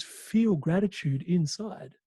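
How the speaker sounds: slow, deliberate, each word given weight; slow emphatic advice. Retold or imitated. Mentor slowly stressing the lesson to the audience. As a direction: lay the words out slowly, like key advice. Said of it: "He goes".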